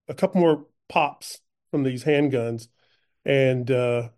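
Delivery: ordinary talk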